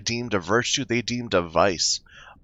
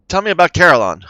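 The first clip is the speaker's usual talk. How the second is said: lightly acted demand; 9 semitones higher, 10 dB louder, faster than usual. Player's line to deviless.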